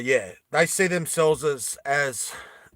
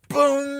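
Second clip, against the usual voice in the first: high, loud, drawn-out boom; booming impact noise; 11 semitones higher, 6 dB louder than usual. Acted. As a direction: sound out a heavy hit. Imitates the blow landing.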